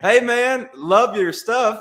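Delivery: loud, high, drawn-out fan greeting; The Rock. Retold or imitated. Comedian voicing The Rock's comment on his pictures, hammed-up and loud. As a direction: imitate an enthusiastic comment, big and stretched.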